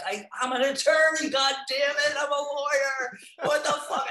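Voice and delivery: high, loud exasperated yelling; half-laughing indignant shout; mock outraged shout. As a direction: blow up at yourself in mock outrage, breaking into laughter. Retold outburst at himself on bus.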